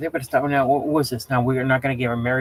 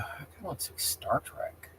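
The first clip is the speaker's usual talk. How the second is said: whispered aside, barely voiced; 13 dB quieter than usual. Real, not acted. Streamer whispering an aside to chat. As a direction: breathe it out under your breath.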